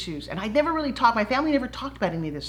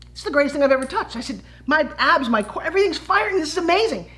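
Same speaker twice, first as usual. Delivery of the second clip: higher and louder, bubbling over; excited gush; 7 semitones higher, 3 dB louder than usual. Retold or imitated. She relives telling the inventor his toy thrilled her, excitedly. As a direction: gush like you just found treasure. Retelling first thrill with product.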